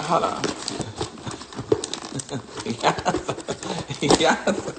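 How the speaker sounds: ordinary talk, nothing marked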